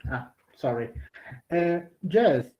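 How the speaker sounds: ordinary delivery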